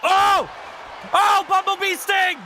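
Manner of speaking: high, loud play-by-play yell; real hyped-up commentator scream; excited shout. Real, not acted. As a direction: erupt at a big wrestling move. Calling a big move in match.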